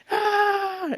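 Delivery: high loud cheer; a loud, high rally scream. Retold or imitated. Sister cheering with sign.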